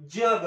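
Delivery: जग with a plain dental ja, no y-glide; palatal ja. Shown as a demonstration. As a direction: say जग with the tongue on the teeth ridge. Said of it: "कसा उच्चार करणार?". (दंत तालव्य जग)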